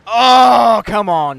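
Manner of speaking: loud exasperated yell; frustrated shout. Real, not acted. Frustrated near miss.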